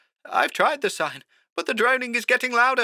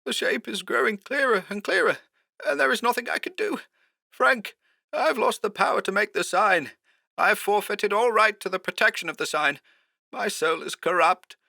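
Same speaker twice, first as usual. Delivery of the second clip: high, softer, despairing phone voice; hoarser and afraid; 13 semitones higher, 4 dB quieter than usual. A narrator's reading. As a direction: confess in hushed dread. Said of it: "more hoarsely this time".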